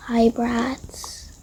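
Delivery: usual speaking voice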